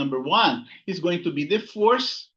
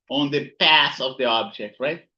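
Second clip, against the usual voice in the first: usual speaking voice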